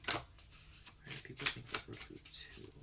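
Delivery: breathy whisper, no voice at all; whispered intently to herself; whispered question. Real, not acted. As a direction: whisper it down to the cards.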